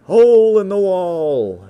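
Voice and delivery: very loud, high, slow title call; booming proclamation. Acted. Announcing friend's poem aloud, loud drawn-out proclamation. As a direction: belt out the title theatrically.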